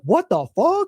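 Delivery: high, sharp mock outburst of disbelief; a half-joking shriek of outrage; half-joking yell. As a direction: yell it half in jest but mean it. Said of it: "I was, like, half joking, but I was serious".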